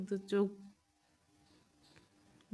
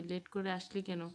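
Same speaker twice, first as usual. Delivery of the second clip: ordinary delivery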